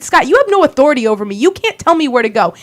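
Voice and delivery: very fast, clipped defiance; rapid retort. Retold or imitated. To Scott ordering her out, fast defiant retort. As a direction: rattle it off right back at him.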